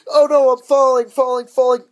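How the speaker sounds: loud, high cartoonish cry; hammed-up cry of someone falling; mock panic. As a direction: yell it in mock terror. Acts out falling from the ship.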